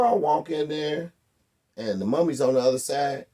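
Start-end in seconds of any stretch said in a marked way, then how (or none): none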